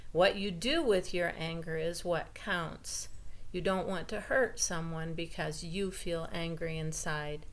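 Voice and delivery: plain reading voice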